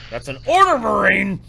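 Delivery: high, loud barked command; drill sergeant. Pretend. Barked military order to friend.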